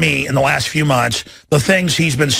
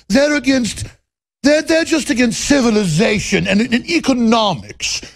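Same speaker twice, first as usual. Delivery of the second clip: hammed-up condescending snob; Thurston Howell. Snob lecturing little people on economics, affected and condescending. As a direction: lecture down in an affected British-tinged voice. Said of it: "let me talk just like he does".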